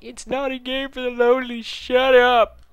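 high, loud mock whine; mocking whiny voice. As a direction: mimic the offended lonely gamers. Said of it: "all lonely that are like".

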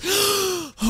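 sharp, high gasp of terror; terrified gasp. Real, not acted. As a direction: gasp in sheer fright. Terror at the attack.

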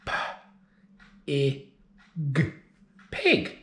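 segment P, I, G, then Pig; sounding out words. Shown as a demonstration. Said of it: "Do it with me".

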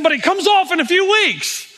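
high, happy voice; pleased he'll be free soon; eager aside. Retold or imitated. Recovering man telling his pastor about the ankle bracelet. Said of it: "He said".

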